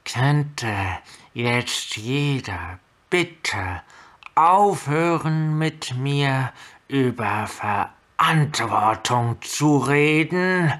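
slow, through gritted teeth; barely contained rage, slow; holding back a shout. Acted. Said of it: "so langsam und so ruhig, wie es ihr durch zusammengebissene Zähne möglich war".

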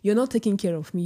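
ordinary delivery

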